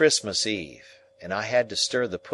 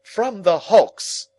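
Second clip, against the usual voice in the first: much higher, louder, slow reply; curt sister; 13 semitones higher, 9 dB louder, much slower than usual. Acted. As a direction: bite off the answer. Sister answers curtly.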